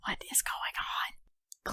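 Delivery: unvoiced, slow confused whisper; bewildered whisper. Party member whispering to the crew, bewildered whisper. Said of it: "looking around in bewilderment".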